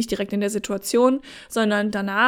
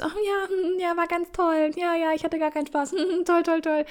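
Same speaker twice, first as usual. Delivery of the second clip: fake satisfied partner; 5 semitones higher than usual. Mimics woman faking satisfaction after sex.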